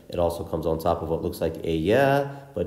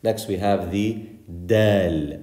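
normal talking voice

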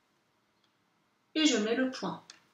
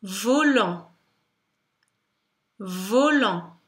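careful dictation; much slower than usual. Shown as a demonstration. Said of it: "en disant le mot".

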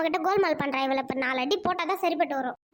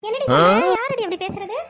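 shocked; 2 semitones higher, 6 dB louder than usual. Acted. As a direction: jolt at the news, louder. Hears gossip about herself. (அதிர்ச்சியில் சத்தமாக)

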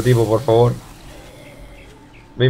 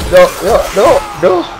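rising panicky no's; panicked; 4 semitones higher, slower than usual. Real, not acted. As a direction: yelp it higher and higher in panic. Panic while attacked.

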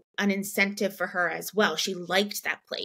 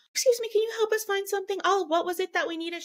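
very high, fast and chirpy; bright retail voice; 11 semitones higher, faster than usual. Retold or imitated. Mom asking a Target employee for help, very high and fast. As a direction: chirp it up for the store clerk.

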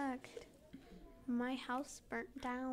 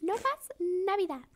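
playful; 8 semitones higher, 6 dB louder than usual. Pretend. Joking switch into Spanish.

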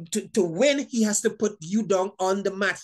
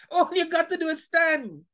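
laughing; 7 semitones higher than usual. Real, not acted. Laughs after shouting stand.

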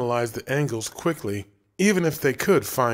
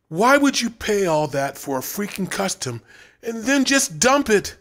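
rising, higher-pitched disbelief; frustrated rant; 9 semitones higher, 3 dB louder than usual. A narrator's reading. Brent ranting about the dumped custom child, frustrated. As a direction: vent at the waste, climbing up.

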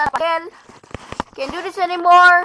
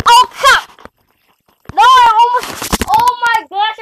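frustrated yell; 7 semitones higher, 5 dB louder than usual. Real, not acted. Gamer nearly makes it.

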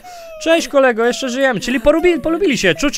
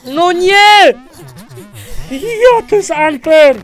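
loud, high, indignant protest; frustrated outcry; 8 semitones higher, 7 dB louder, much slower than usual. Real, not acted. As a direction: yell at the unfair game. Outcry at being beaten in the game. (głośny, oburzony okrzyk, wysoko)

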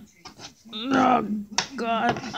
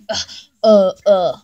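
usual speaking voice

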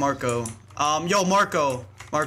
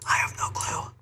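whispering. Real, not acted. Whispering aside during German chat.

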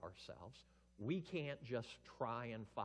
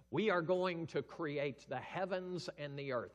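grand Father voice; 6 semitones higher, 9 dB louder than usual. Acted. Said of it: "The Father says".

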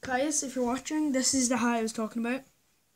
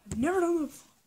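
louder, higher; 7 semitones higher, 3 dB louder, faster than usual. Real, not acted. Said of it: "If you could not hear me".

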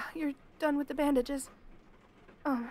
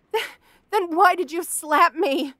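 high, loud and slowed, wounded; 4 semitones higher, 9 dB louder than usual. Acted. Questioning the captor who just slapped her, loud and wounded. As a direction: force the words out through the hurt. Confronting captor after slap.

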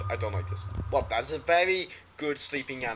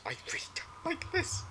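high, unvoiced frightened whisper; scared whisper; 8 dB quieter than usual. Real, not acted. Streamer creeped out by the horror game, whispering. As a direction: breathe it out, spooked. Whispered repeat after plain take.

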